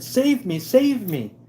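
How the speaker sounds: high, mocking plea for rescue; mock damsel in distress. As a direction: squeal it like a helpless damsel. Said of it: "And you would have said".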